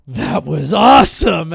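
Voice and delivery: put-on loud, slow kid voice; thrilled kids. Kids telling dad the blast was awesome, loud and slow. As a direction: mimic kids in awe. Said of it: "my kids are like".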